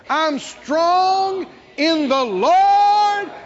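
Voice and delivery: "Lord" shouted high and held long; loud, stretched-out "Lord" at the end; stress on LORD. Shown as a demonstration. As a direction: proclaim slowly, landing hard on "Lord". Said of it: "begin to emphasize each word".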